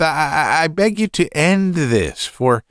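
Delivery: high, stammering plea; breathless son pleading with father; gasping for breath. A narrator's reading. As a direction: beg him, tripping over the first word. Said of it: "gasping for breath".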